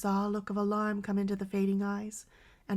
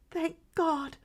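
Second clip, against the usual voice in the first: high, relieved gasp of joy; first thanks, breaking with joy; tearful relief, higher; glad tearful cry; 8 semitones higher, 3 dB louder than usual. A narrator's reading. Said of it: "cried the mother with a rush of glad tears".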